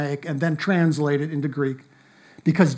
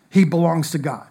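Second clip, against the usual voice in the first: ordinary delivery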